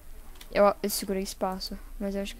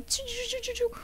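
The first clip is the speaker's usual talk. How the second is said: whooshing harpoon noises; 13 semitones higher than usual. Retold or imitated. Imitating harpoons flying past.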